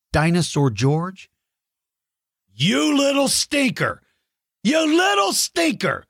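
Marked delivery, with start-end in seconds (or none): 2.5-6.0 high, playful scolding; mock outrage. Real, not acted. Mock outrage at being eaten.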